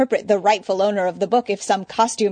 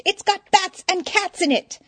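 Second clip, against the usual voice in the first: high excited exclamation; exclaiming; 12 semitones higher, 3 dB louder than usual. Acted. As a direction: cry it out, voice way up. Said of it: "Eddie exclaimed".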